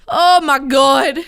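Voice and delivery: exclamation. Real, not acted.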